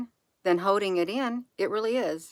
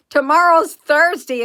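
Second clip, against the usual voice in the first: breaks into a loud laugh, pitched higher; laughing out the word Thursday; 11 semitones higher, 12 dB louder than usual. Real, not acted. Laughs at dreading tomorrow.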